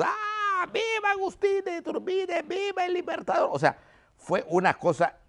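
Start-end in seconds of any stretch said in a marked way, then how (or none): 0.0-3.5 very high, strained cheer of a jubilant crowd. Retold or imitated. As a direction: cry out like townsfolk hailing a liberator. (multitud eufórica que vitorea a Iturbide, con voz muy aguda)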